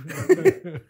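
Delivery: loud, amused burst of laughter; real hearty laugh. Real, not acted. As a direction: laugh out loud before telling the story. Laughing at own coming story.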